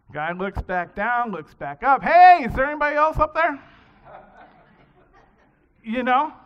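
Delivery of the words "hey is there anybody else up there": a man on a branch shouting for a different answer; loud call. Acted. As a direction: shout upward, much louder and higher. Man yells for someone else.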